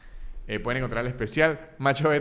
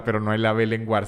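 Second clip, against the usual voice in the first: normal talking voice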